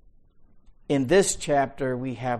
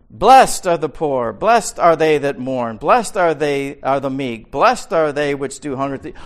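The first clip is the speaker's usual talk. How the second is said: solemn scripture reading; 2 semitones higher, 10 dB louder than usual. Acted. Reads the Beatitudes aloud.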